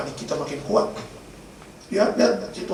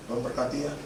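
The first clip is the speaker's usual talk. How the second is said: low, very soft retold blessing; hushed, solemn send-off; quiet blessing; 4 semitones lower, 10 dB quieter than usual. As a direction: murmur a blessing to your wife. Blessing wife before surgery, retold.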